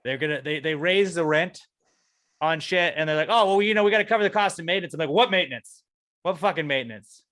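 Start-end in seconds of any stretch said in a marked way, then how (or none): 3.3-4.9 high, loud, fast mock excuse; mocking landlord. 5.1-7.2 indignant retort. Retold or imitated. Angry retort to landlord.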